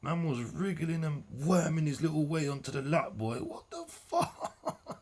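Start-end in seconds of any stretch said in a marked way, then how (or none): 3.4-5.0 drawn-out question breaking into a high laugh; helpless, high-pitched incredulous laughter; laughing disbelief. Real, not acted. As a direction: crack up while asking, stretching it out slowly. Reacts incredulously to the clip.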